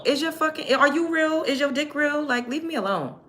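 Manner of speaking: high-pitched, irritated mocking questions; exasperated comeback. Real, not acted.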